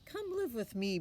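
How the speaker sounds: put-on boy's line. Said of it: "Brian said".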